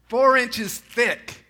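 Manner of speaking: four inches thick slowed right down, loud and high; emphatic build. Acted. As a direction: hammer home "four inches thick" slowly and loudly. Preacher stresses veil thickness.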